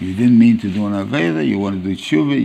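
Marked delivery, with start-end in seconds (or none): none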